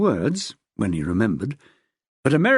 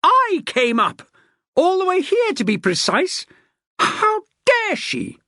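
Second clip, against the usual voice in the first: furious screech. A narrator's reading. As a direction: shriek it out in disbelief. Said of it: "the woman screeched".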